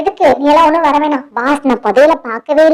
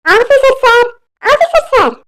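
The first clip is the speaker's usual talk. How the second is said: high, urgent calls for the officer; Subbu calling out; 7 semitones higher than usual. Acted. Villager Subbu summons the officer to the fight, high urgent calling. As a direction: call the officer from a distance, pitched up. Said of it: "சுப்புவோட குரல் கேக்குது".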